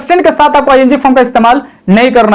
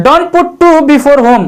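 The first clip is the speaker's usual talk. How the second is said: very slow, word by word rule; slow rule read; much slower than usual. Shown as a demonstration. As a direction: read the rule out slowly for the class. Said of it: "mistake number third you have". (धीरे-धीरे पढ़ा गया नियम)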